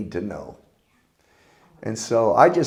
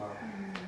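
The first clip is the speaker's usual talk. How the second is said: high breathy laugh; 9 semitones higher, 17 dB quieter than usual. Real, not acted. Laughing at own joke.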